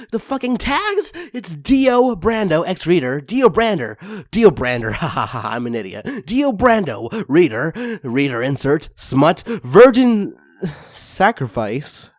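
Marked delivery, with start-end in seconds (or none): none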